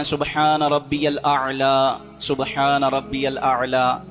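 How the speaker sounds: سبحان ربی الاعلیٰ drawn out slowly with clear ع; careful model recitation; slow clear tasbih. Shown as a demonstration. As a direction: recite each word unhurried. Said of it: "تین مرتبہ تسبیح پڑھیں".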